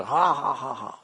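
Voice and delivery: high squawking crow; rooster crow. Retold or imitated. Rooster flapping its feathers, high squawking crow.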